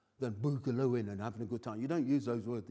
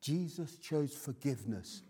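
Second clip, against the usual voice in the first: usual speaking voice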